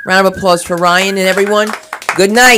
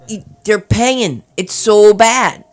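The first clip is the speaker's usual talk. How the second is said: normal talking voice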